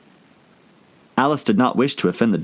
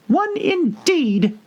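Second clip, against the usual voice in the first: very high, slow, offended squeak; the Dormouse bristling at Alice, high and slow; indignant Dormouse; much slower than usual. A narrator's reading. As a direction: scoff at her in a tiny voice. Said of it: "said the Dormouse indignantly".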